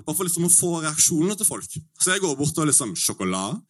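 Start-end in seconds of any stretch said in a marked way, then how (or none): 3.0-3.6 sjokolade said much lower. Shown as a demonstration. Testing the word on locals in a low tone. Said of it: "i alle mulige tonefall".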